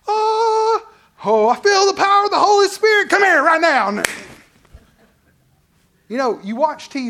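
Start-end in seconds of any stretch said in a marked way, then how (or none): 0.1-4.0 high, loud hammed-up healer shout; mock faith healer. Pastor mocking TV preachers with a loud, high faith healer shout. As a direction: overblown TV healer feeling the power.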